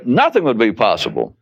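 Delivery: normal talking voice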